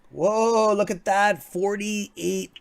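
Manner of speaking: high, loud surprised whoa; real jolt of surprise at the screen. Real, not acted. Streamer reacting to a huge stock order with a loud surprised whoa.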